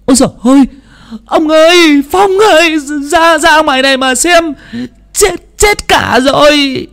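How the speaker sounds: wife calls husband and Phong in panic, very high and loud; panicked cry. Acted. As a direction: scream for the men to come see. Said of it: "giọng bà Hồng lại hốt hoảng".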